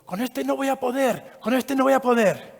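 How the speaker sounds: put-on high, slow child's voice, discouraged; worried kid. Opponent boy tells his family he can't win, high and slow. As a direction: play the rival boy giving up before the bout. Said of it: "el niño ... le dice a la familia".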